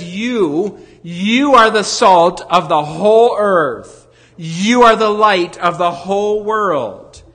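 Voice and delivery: slow, stately quoting of Jesus; solemn proclamation. Acted. Preacher quoting Jesus to congregation, solemn proclamation. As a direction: proclaim it like scripture from the pulpit.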